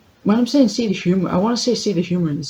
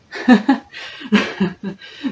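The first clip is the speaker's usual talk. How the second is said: burst of laughter; 5 semitones higher than usual. Real, not acted. Laughing at her own slip.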